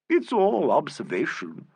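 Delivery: slow, pleased and proud; gratified. A narrator's reading. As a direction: say it savouring the praise. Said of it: "in a gratified tone".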